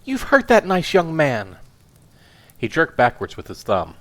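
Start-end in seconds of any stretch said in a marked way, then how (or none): 0.0-1.6 outraged wife. Acted. Wife scolds husband.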